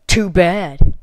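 loud, dismissive too bad; loud, curt dismissal; loud retort. Real, not acted. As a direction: snap it back. Retort to bot.

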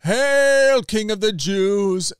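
very high, loud, slow mock hail; kneeling soldiers taunting Jesus. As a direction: jeer it like a fake salute.

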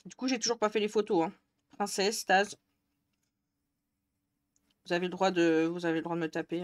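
talking while chewing; mouth full. Real, not acted. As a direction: speak through a mouthful of food. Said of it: "Je vais parler la bouche pleine".

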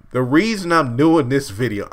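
normal talking voice

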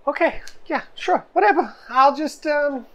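high-pitched, hesitant go-along; hesitant character voice. Acted. Jinx goes along with the odd crew, hesitant high voice. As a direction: agree reluctantly in a high little voice.

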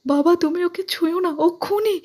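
very high, loud tearful warning; crying plea. A narrator's reading. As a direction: cry out in a high voice. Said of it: "সোনা কেঁদে কেঁদে বলছে".

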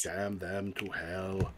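low, slow, soft mock damnation; mock solemn curse. Game master playfully cursing the relatives, low slow mock solemnity.

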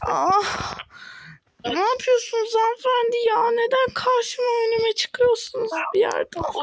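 high, shouting after a crash; angry shout. Pretend. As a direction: yell at the driver who cut you off. Car crash quarrel.

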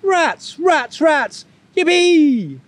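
very high excited squeal. A narrator's reading. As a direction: squeal it like thrilled little bunnies. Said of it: "squealed the bunnies".

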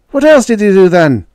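very high, loud question; reporter calling out. A narrator's reading. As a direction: shout it from a press pack. Reporters call questions to Jake.

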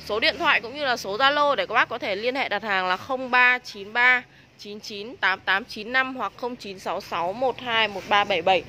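normal talking voice

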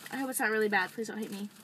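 normal talking voice

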